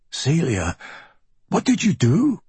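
alarmed, pitch jumping up; a shocked, urgent plea; shocked question. A narrator's reading. Marco shocked by blood.

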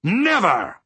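high, forceful "Never!" falling at the end; adamant, high-pitched exclamation; emphatic repeat. A narrator's reading. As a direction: thunder "Never!" back as final. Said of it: "he repeated".

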